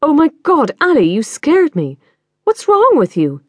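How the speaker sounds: high, loud startled outburst. Acted. Mother startled by her daughter's scream, high and loud. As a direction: jump out of your skin, then scold.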